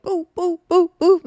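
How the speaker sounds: playful sound effects for a flurry of punches; imitated punch noises.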